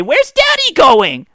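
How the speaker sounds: put-on high, loud, slow child question; sweet kid voice. Dad fed up with the kid, high kid voice. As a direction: chirp it like a small child.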